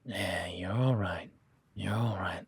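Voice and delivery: slow, gentle reassurance; calming. Acted. Rider reassuring his nervous horse, slow and gentle. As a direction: ease a skittish horse, slowly.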